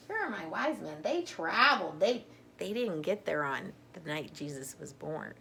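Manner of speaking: ordinary delivery, nothing marked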